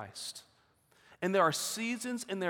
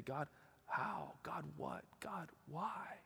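barely audible, much softer cry to God; despairing prayer; 3 semitones lower, 15 dB quieter, much slower than usual. Retold or imitated. Grieving father asking God why, barely audible. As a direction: breathe the questions out, near silent.

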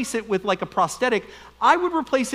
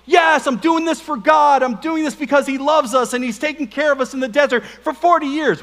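self-justifying Achan; 5 semitones higher, 3 dB louder than usual. Retold or imitated. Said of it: "Achan's like".

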